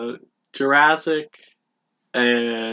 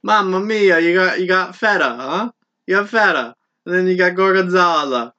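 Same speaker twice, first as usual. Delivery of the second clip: loud, hammed-up Italian accent; 4 semitones higher, 5 dB louder than usual. Cohost shows off after failed New York try, mock Italian accent. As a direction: put on a higher, exaggerated Italian lilt. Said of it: "in an Italian accent".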